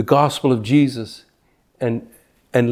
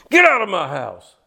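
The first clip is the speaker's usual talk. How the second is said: higher, retold outburst of an angry host; mock angry yell; 5 semitones higher than usual. Pastor retelling angry homeowner ordering him out, mock yell. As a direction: imitate the man throwing you out, raised pitch. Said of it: "He was so mad".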